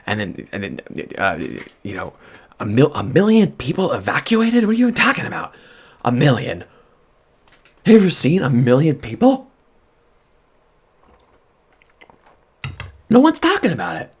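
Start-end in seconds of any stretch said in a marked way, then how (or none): 2.6-14.1 high, loud outraged questions; loud, high-pitched freakout; freaking out. Real, not acted. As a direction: rant in disbelief. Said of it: "Sorry for freaking out".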